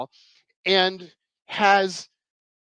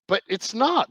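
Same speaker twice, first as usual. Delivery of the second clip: usual speaking voice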